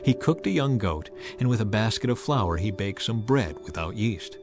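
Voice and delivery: ordinary talk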